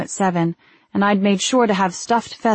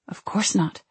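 whispered; 4 dB quieter than usual. Acted. Placating an angry husband.

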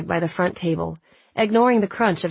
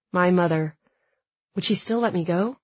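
ordinary delivery, nothing marked

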